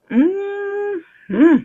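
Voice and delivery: high rising mm-hmm; high savoring hum. Real, not acted. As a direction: hum up with delight. Delight after tasting.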